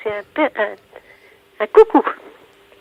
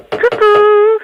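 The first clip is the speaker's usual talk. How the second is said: higher, louder sing-out coucou; calling out; 8 semitones higher, 5 dB louder than usual. Real, not acted. Woman calls to supposed bird, calling out. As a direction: call to a bird to get an answer.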